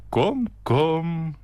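high, singsong hostess; singsong coaxing. A narrator's reading. Hostess urging the guest to eat, singsong. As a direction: sing it out to coax. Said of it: "zong ze".

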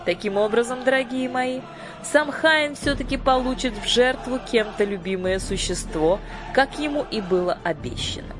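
high, commanding fairy-queen voice; imperious sorceress. A narrator's reading. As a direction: proclaim it from the throne. Lady Batp speech.

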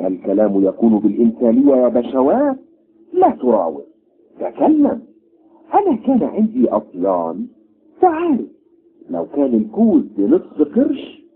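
corn vendor voice. Acted.

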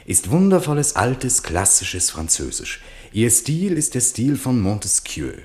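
viel höher und lauter, begeistert ausgerufen; schwärmt vom klassischen Französisch; begeistertes Zitat. Retold or imitated. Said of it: "hatte Malraux zu ihm gesagt". (Malraux schwärmt dem General gegenüber, hoch und laut)

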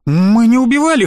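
high, loud protesting cry; a boy's panicked cry of innocence; wanted to shout. A narrator's reading. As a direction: shout it in desperate denial. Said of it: "хотел крикнуть я".

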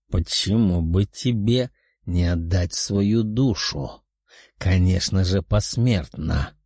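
low, slow demonic voice; menacing demon. A narrator's reading. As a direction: sneer down at puny humans. Demon character voice.

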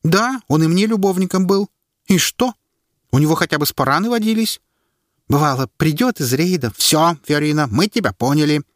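a brash woman defending herself; voiced woman. A narrator's reading. Фиорина snaps back at the herald in the crowd. Woman arguing at public trial.

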